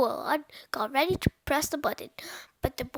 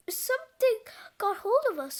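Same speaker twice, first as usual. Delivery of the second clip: mild higher-pitched alarm; raised-pitch storybook exclamation; startled cry; 8 semitones higher than usual. A narrator's reading. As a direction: cry out in puzzled surprise. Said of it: "cried Cheeseball".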